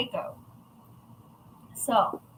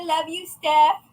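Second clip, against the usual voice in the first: ordinary talk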